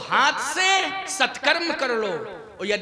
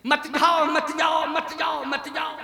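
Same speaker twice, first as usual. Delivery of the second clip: higher, repetitive mock plea; pleading wife; 4 semitones higher than usual. Preacher mimics wife at husband's deathbed, mock pleading. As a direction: imitate a wife begging, pitched up. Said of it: "तो पत्नी कह रही थी".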